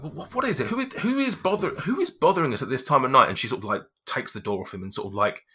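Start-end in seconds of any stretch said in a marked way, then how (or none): 0.0-3.3 much higher, loud fussy demand; wife annoyed at being disturbed so late; indignant wife. Acted. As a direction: huff at the late intrusion. Irritated wife at door.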